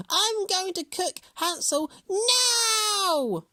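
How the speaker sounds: high, loud, slow hag shriek; screeching witch. A narrator's reading. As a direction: shriek with greedy glee. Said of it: "she screeched".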